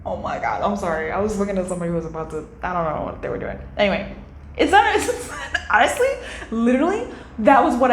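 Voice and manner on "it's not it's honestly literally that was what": high, laughing through the words; laughing while talking. Real, not acted. Laughing to viewers about the man outside. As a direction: still giggling as you try to talk. Laughing at what she saw.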